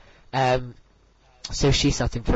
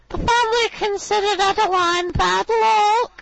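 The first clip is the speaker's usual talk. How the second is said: impression of Beverly; 3 dB louder than usual. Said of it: "she speaks like this".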